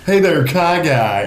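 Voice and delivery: slow, drawn-out hey there; lightly imitating online gamers; mock gamer greeting. Host imitating gamers greeting Kai Guy, slow and mocking. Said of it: "gamers on Xbox Live that would say".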